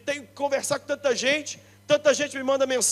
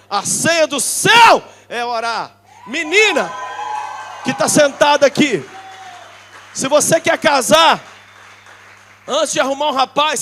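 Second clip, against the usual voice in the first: preaching shout; 8 dB louder than usual. Real, not acted. Preaching at full force.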